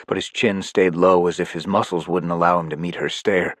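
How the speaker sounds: usual speaking voice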